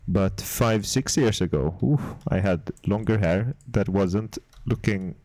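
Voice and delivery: badly faked Swedish accent, close on the mic; casual chat in a put-on Swedish accent; terrible Swedish accent. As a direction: keep up the mock Swedish accent for ASMR listeners. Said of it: "like my terrible Swedish accent".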